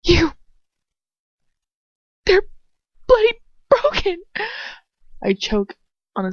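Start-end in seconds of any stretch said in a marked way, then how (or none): 0.0-4.2 high, loud sobbing outcry; loud, tearful cry of pain; anguished cry. Acted. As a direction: scream it through the pain. Hermione cries out in pain.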